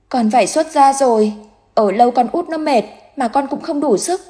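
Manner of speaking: put-on high, thin child's voice, scared and pleading; a drowned boy recalling the cold water; frightened ghost child. A narrator's reading. Dead son telling mother how he drowned, high and frightened.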